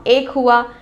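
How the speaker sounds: ordinary talk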